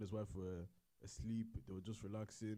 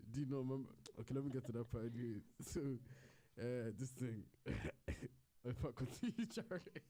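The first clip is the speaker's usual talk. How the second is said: ordinary talk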